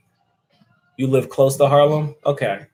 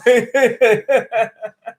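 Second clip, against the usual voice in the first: a higher laughing fit; amused higher laugh; high laughter; 10 semitones higher, 5 dB louder than usual. Real, not acted. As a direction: keep cracking up. Laughs again at the chat.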